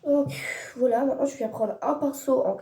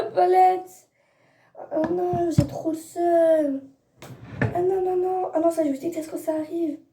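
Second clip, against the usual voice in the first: high, loud, dismayed whine; gutted, high-pitched lament; upset wail; 7 semitones higher, 4 dB louder than usual. Real, not acted. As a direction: protest in real dismay, pitched well up. Real dismay over her palette.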